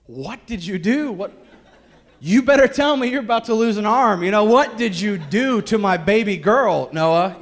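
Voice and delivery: a mock-furious father grilling his son; laughing dad yelling at his son; angry dad. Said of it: "I'm like".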